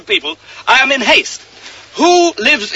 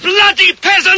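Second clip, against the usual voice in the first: angry outburst; 7 semitones higher than usual. Acted. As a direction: spit it out in fury. King bursts out.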